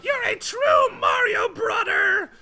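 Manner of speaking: very high, loud, drawn-out cartoon shout; over-the-top squeaky yell; Toad. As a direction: proclaim it slowly in a piercing high voice. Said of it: "he says".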